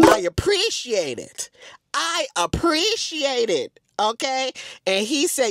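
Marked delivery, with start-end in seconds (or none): 0.0-1.5 slow, deliberate acted reply; drawn-out thanks. Acting out own flattered reply. 1.9-3.8 higher, slow, drawn-out thanks; exaggerated, lilting mock-polite reply; hammed-up gratitude. Repeats the reply, hamming it up.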